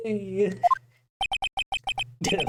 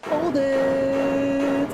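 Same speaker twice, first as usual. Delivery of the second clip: objection shout; 7 semitones higher than usual. Acted. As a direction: call it out firmly, higher. Objection in courtroom game.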